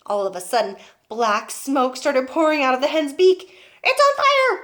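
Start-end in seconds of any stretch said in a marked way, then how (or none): 3.8-4.6 high, loud laughing yell; alarmed yell. Acted. Grandma yells out as the hen smokes, high and loud. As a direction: cry out the alarm. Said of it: "Grandma yelled".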